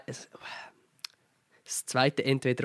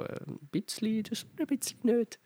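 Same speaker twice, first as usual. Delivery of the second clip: voice giving out; 9 semitones higher, 5 dB quieter than usual. Real, not acted. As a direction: voice cracking and breaking up high. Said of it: "meine Stimme versagt grad total".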